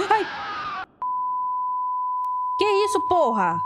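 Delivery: sudden high shout of shock; startled shout. Real, not acted. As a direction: yelp it out, caught off guard. Jump scare in horror game.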